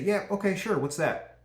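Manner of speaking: high, bright, go-with-the-flow yes; the right way, an agreeable high okay; easygoing agreement. Shown as a demonstration. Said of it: "You just say".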